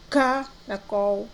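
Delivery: "caracol" said slowly with a hard K; sounding out. Shown as a demonstration. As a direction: stress the K in "caracol". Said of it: "mesmo som de casa".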